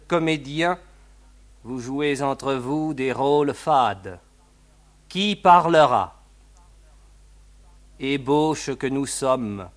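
measured, solemn reading with long pauses; poem recital. Acted. Radio host reads a Grosjean poem to listeners, solemn recital. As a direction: set each phrase apart like verse.

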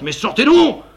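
high, loud exasperated yell; furious demand. Acted. Marquis yelling at the empty wardrobe, angry.

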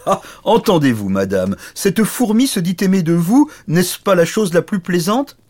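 loud, high jeering oaf; blustering, derisive knight; big knight's mocking amusement. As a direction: ridicule the tiny rival to the lady. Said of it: "éclata de rire".

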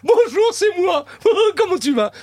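over-the-top high-pitched cartoon lady; Madame Doubtfire. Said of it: "Madame Doubtfire".

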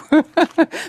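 loud amused laugh; real laugh; burst of laughter. Real, not acted.

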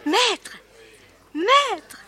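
calling out. Acted. Girl calls out to the author.

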